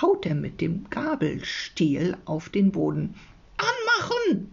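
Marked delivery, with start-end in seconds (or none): 3.6-4.5 very high, squealed creature command; high-pitched yell at reading volume; mock bellow. A narrator's reading. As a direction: squeal the order like a bossy creature. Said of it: "brüllt er".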